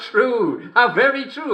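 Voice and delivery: high-pitched gleeful exclamation; delighted agreement. Acted. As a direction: exclaim it high, thrilled by the joke. Delighted reaction to a joke.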